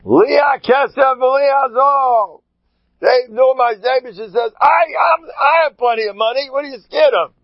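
high, loud, slow ringing cry; fervent proclamation. Retold or imitated. Rabbi answering the worried school board, fervent high shout. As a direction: proclaim it with full faith. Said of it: "You know what Byron answered?".

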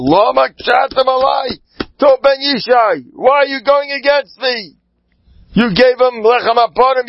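a king's furious accusation; angry king. Acted. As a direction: thunder at him as the wronged king. King Saul rebukes the priest.

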